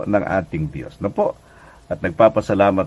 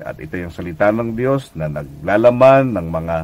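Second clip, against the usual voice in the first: ordinary talk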